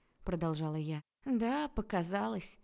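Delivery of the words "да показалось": sighing. A narrator's reading. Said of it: "вздохнул Арчи".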